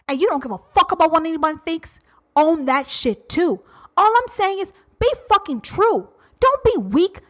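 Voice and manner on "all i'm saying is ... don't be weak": usual speaking voice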